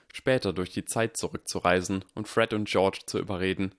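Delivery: plain reading voice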